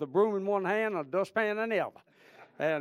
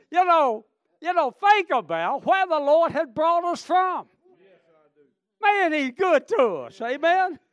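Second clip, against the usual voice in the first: loud, high revival shout; fervent pulpit cry; full-force preaching; 9 semitones higher, 11 dB louder, slower than usual. Real, not acted. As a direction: preach it high and hard to the crowd.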